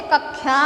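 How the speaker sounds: कक्षा said as "kakkhya"; kh-y sound replacing ksh in कक्षा; Karauli pronunciation of कक्षा. Retold or imitated. Teacher imitates Karauli locals, कक्षा as "kakkhya". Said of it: "वो बोलते".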